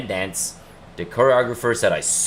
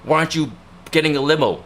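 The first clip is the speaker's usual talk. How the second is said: higher, scolding mock father; imitating dad's scolding, pitched up; nagging dad; 6 semitones higher than usual. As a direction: whine at him like a stingy-hating dad. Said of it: "He was like".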